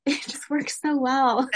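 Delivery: slow, laughing admission; laughing confession. Real, not acted. Laughing confession of bribing kids.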